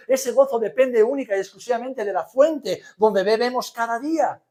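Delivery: loud, high, insistent pulpit build; full-force preaching. Real, not acted. Preacher telling the church where joy comes from, full-force preaching. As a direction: hammer each clause, building to a ringing climax.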